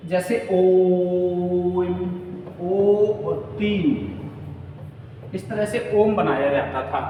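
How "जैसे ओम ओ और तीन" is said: ओम drawn out very slowly as a pluta vowel; prolonged om. Shown as a demonstration. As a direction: chant ओम and ओ stretched to three beats. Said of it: "प्राचीन काल में प्लुत स्वर के लिए".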